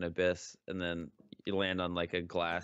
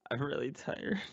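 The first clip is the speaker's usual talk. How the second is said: ordinary talk, nothing marked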